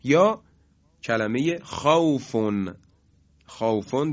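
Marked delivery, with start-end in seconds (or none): none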